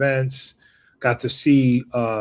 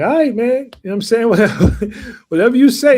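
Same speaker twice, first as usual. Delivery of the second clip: higher and louder, laughing brush-off; mocking dismissal; 10 semitones higher, 7 dB louder, much faster than usual. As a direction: laugh off the doubter. Brushing off doubting moderate Dem.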